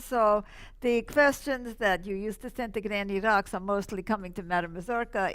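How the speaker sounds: ordinary talk, nothing marked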